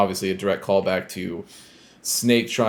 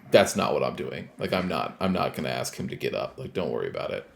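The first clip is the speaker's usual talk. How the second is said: soft, low reassurance, fading quieter; gently calm her down, lower and softer; reassuring; 7 semitones lower, 5 dB quieter, faster than usual. Retold or imitated. Snake reassures Sunny, hand on her shoulder, softly. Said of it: "reassures her".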